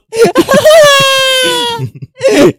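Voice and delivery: high, loud burst of laughter; shrieking laugh. Real, not acted. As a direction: cackle it out at the top of your voice. Laughs at the fart joke.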